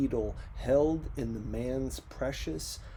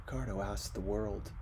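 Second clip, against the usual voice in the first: normal talking voice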